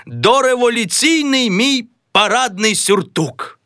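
high, very slow pompous recital; a high, grand father of the house; Mazailo in role. Acted. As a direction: declaim it, then give the order. Mazailo recites, then orders.

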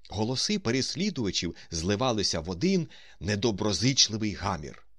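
plain reading voice

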